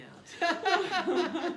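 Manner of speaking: laughing. Real, not acted. Laughing at own story.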